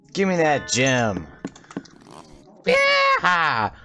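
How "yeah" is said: excited high yell. Real, not acted. Cheering while smashing things.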